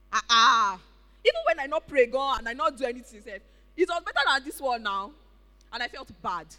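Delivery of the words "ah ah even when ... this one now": frustrated complaint. Retold or imitated. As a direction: vent the frustration at God. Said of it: "this is the result I'm getting".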